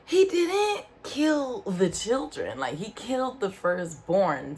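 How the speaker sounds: high, slow, insistent correction; exasperated correcting. Retold or imitated. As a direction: spell it out slowly, fed up. Correcting him about the plague.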